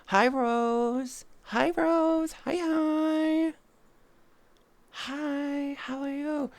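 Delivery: very high, sweet hi to a chatter; high squeaky greeting. Real, not acted. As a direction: squeak out a cute hello.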